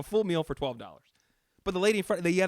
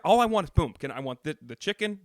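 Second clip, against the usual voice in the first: usual speaking voice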